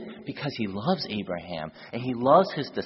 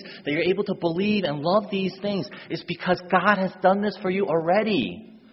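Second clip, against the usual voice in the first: louder, higher, driving sermon voice; forceful preaching; 6 semitones higher, 5 dB louder than usual. Acted. Preacher urging listeners to hold on to God's promise, forceful and raised. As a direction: press the point home to the congregation.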